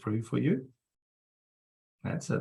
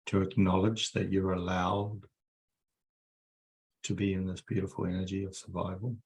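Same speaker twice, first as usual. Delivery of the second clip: guided meditation. Acted. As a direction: ease them down into calm. Leads a guided energy meditation.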